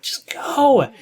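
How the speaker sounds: high whispered "Just go"; urging whisper. Acted. Commentator whispering to the runner, urging whisper. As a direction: breathe it out, willing the player to run.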